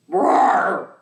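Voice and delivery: loud playful dinosaur roar. Pretend. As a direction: roar big for the kids like a dinosaur. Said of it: "roar like a dinosaur".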